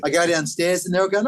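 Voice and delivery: normal talking voice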